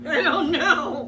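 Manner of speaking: slow, loud laugh through the words. Real, not acted. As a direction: laugh as you say it. Laughing over the couch.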